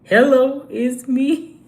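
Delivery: "Hello" thrown out loud and rising. Retold or imitated.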